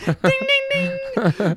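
very high, loud dinging; imitated ring bell.

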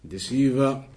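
usual speaking voice

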